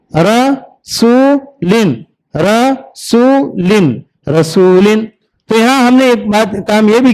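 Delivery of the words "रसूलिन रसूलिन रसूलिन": slow drawn-out demo. Shown as a demonstration. Teacher drawing out रसूलिन slowly for children. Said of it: "आ दन, आदन".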